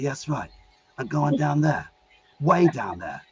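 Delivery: lightly voiced British action hero; a hint of a British tough guy; Jason Statham. Gabriel tells Michael he's going way down, light British voice. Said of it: "I put on the voice".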